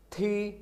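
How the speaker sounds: थी said high with a rising second tone. Shown as a demonstration. Teacher demos थी to Hindi learners, high rising tone. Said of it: "इस पर second tone है तो हम इसको ऊंचा पढ़ेंगे".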